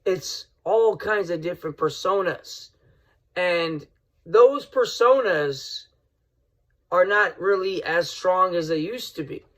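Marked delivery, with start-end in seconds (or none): none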